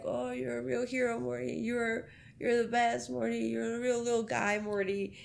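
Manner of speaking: put-on drunk praise. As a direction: mumble fond praise like a drunk. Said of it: "Wasted as fuck".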